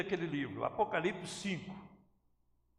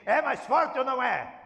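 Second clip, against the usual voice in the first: shout; 5 semitones higher, 11 dB louder than usual. Real, not acted. Pregador pergunta em brado.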